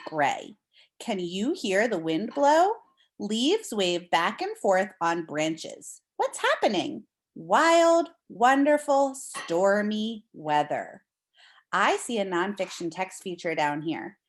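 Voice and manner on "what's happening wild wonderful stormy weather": ordinary talk, nothing marked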